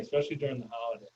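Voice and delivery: ordinary talk, nothing marked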